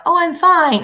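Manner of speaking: airy, dismissive "Oh, I'm fine" swept up high; breezy fake fine. Shown as a demonstration. Teacher contrasting two ways of saying fine, dismissive. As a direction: brush it off with a bright, loud "Oh, I'm fine". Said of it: "there's a world of difference".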